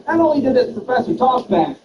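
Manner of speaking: usual speaking voice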